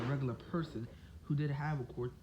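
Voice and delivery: ordinary talk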